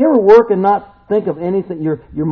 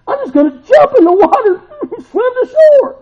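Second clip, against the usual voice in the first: much higher, louder, laughing burst; eager resolve; 9 dB louder than usual. Retold or imitated. Peter deciding to jump in and swim to Jesus, laughing burst. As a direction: leap into it with joyful abandon. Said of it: "it's almost like he's thinking".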